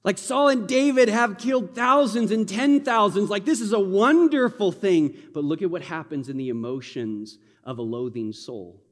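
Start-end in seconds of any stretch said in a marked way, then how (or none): 0.0-5.2 higher, louder, celebrating the victory; celebration. Shown as a demonstration. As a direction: cheer it like a victory song. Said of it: "Do you hear the celebration in that?".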